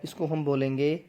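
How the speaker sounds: ordinary talk, nothing marked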